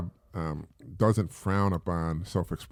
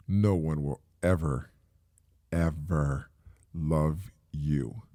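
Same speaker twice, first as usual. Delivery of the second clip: cold parent; 5 semitones lower, much slower than usual. Retold or imitated. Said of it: "Dude, that's cold".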